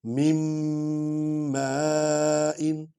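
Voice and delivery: مِنْ مَاءٍ very slow, the meem merged and held nasally; nasal ghunna demo. Shown as a demonstration. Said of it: "میم کو میم میں ملائیں گے".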